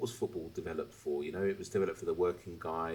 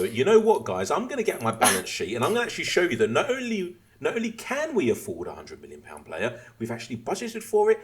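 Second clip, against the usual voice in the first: loud, raised sarcastic impersonation; mock candour; 8 semitones higher, 10 dB louder, faster than usual. Moron manager revealing the balance sheet, sarcastically eager. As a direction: play a foolishly forthcoming boss, hammed-up.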